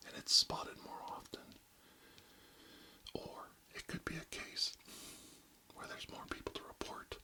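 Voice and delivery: ASMR whisper, close to the mic; barely audible, intimate whisper. Acted. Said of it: "real close to inaudible whispers today".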